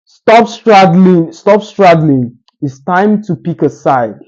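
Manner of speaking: a teacher booming an example sentence; emphatic demo line. Shown as a demonstration. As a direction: press each word out to make the point. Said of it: "and you say".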